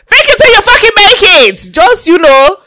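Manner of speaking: high, loud, forceful exhortation; full-voiced, high-pitched command; rousing shout. Real, not acted. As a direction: yell it like a rallying cry. Rousing the listener with the motto.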